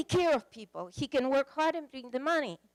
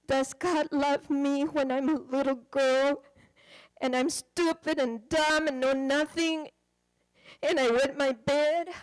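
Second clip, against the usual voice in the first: put-on louder, pleading little-girl plea through tears; tearful child; 6 dB louder than usual. Pastor retelling the wife's tearful childlike question, louder. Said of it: "she started crying".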